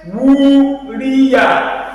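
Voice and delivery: "Uriya" chanted with long U, short ending; stretched first vowel. Shown as a demonstration. Teacher showing only this vowel stretches, "Uriya" chanted. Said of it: "no stretch".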